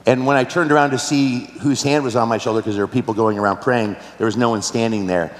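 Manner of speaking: normal talking voice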